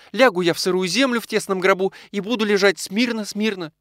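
high, wailing lament; tearful-sounding high complaint, sinking at the end; despairing wife. A narrator's reading. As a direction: pour out self-pity in a high, plaintive voice. Sick wife laments before weeping.

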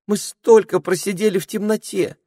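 high eager tiny voice; ringing tiny voice. Acted. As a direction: chirp it eagerly. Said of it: "звенящий голосок".

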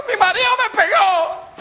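high, slow whimpering complaint; high whining lady. Acted. Beaten wife tells friends, high slow whine. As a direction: whine it out, hurt.

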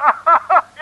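hoge uitbundige lach; luide lach. Acted. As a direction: schater het uit. Makke lacht om de grap. (Makke lacht Loeris hard uit)

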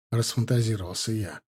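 usual speaking voice

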